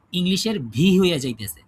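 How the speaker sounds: usual speaking voice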